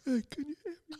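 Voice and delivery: high, soft mocking voices; mock muffled voices. Friend imitating family calling to deafened kid. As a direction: imitate muffled worried callers. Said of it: "everyone that's just like".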